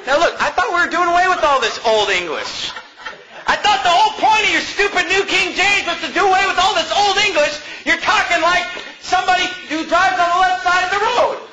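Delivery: high-pitched, indignant complaint; heated pulpit mockery; scornful rant. Real, not acted. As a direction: rant in exasperated disbelief, pitched well up. Mock outrage at the translation's wording.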